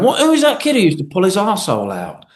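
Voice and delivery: retold quip in a pal's voice, fast; imitating Paddy. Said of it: "he went".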